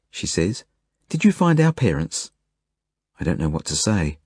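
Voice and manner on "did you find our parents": put-on high, hopeful child's question; hopeful little girl. A narrator's reading. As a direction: ask it like a small girl hoping. Little Zelda asks hopefully.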